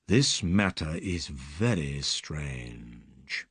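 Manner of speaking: low, slow, pondering; Holmes musing. Acted. Holmes muses to Watson, low and slow. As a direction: think it through aloud, slowly.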